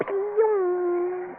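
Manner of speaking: short mock pew noise; a quick cartoon pew; silly pew sound. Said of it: "They just go".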